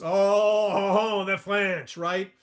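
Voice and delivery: put-on a light Clouseau-style French accent; Inspector Clouseau. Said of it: "when he used to play Inspector Clouseau".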